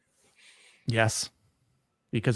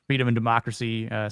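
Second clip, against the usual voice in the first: normal talking voice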